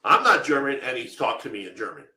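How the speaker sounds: ordinary talk, nothing marked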